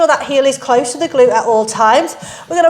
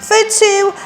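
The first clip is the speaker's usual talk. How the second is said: encouraging countdown; 10 semitones higher, slower than usual. Real, not acted. Counting down final reps.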